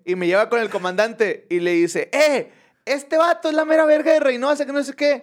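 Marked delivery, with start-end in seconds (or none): 2.1-5.2 high-pitched hyped-up introduction; excited bragging. Retold or imitated. Agent presenting the comedian to the commander, excited. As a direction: show him off in a high voice.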